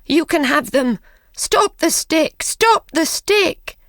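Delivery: put-on a frightened old man pleading, climbing in pitch; desperate pleading. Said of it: "cried the old man".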